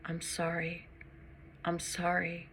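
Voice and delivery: hushed, near-whispered apology. A narrator's reading. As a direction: murmur it very softly, each apology set apart by a pause. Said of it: "I hope he hears my whisper".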